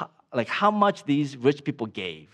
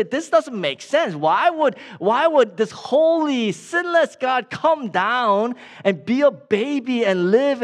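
higher, emphatic disbelief; incredulous objection; 6 semitones higher, 3 dB louder than usual. Acted. Preacher voicing sceptics who reject the birth, incredulous. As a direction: protest in raised, baffled disbelief.

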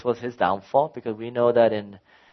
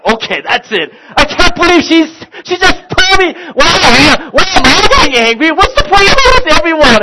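higher and louder, exasperated; fed-up outburst; 12 dB louder than usual. Retold or imitated. Dad venting at wife and family, fed-up and loud. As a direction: boil over at everyone.

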